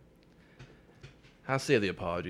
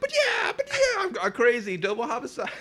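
high, loud hammed-up madness; mock crazed yelling; 7 dB louder than usual. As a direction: shriek it like a gleeful lunatic. Playing a crazed double murderer.

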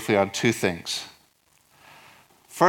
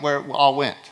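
ordinary talk

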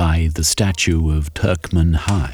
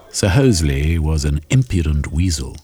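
ordinary delivery, nothing marked

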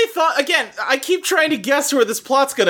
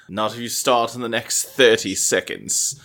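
much lower, cold and curt judge voice; deep disdainful judge brushing off a late dancer; stern judge; 12 semitones lower than usual. Retold or imitated. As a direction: play the jerk ballet judge, low and dismissive. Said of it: "He goes".

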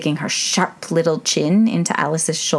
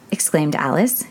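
ordinary delivery

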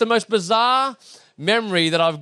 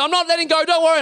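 high-pitched, over-eager promise; acted dad calling out reassurance; loud false reassurance; 10 semitones higher, 3 dB louder, faster than usual. Father promising his son on the bike, high overdone reassurance.

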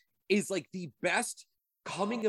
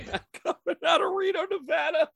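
laughing; 11 semitones higher, 4 dB louder, faster than usual. Real, not acted. Laughing through intro line.

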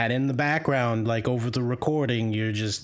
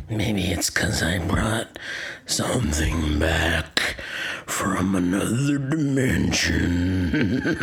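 low, slow, sinister demon growl; deep ominous demon voice; creepy demon voice; 6 semitones lower, slower than usual. Acted. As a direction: menace them slowly from below. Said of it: "doing that accent".

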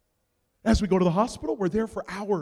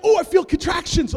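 higher, louder singsong cry; sudden contractions; 9 semitones higher, 9 dB louder than usual. Retold or imitated. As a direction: announce it in a loud wail. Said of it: "she's like".

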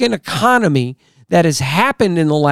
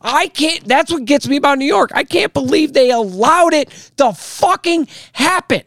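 much higher, strained, furious disbelief; angry disbelief; 12 semitones higher than usual. Real, not acted. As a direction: spit it out, can't believe it. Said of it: "I'm sorry I'm angry".

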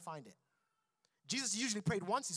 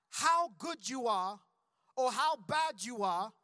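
usual speaking voice

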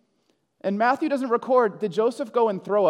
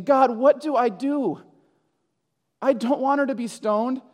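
mock anguished plea; 5 semitones higher, 4 dB louder than usual. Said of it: "like, weeping and crying and saying".

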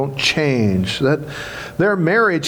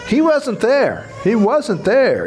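much higher and slow, wonder building; rising excitement; 7 semitones higher, slower than usual. Acted. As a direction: lift the voice high, amazed he's gone. Preacher proclaims empty tomb.

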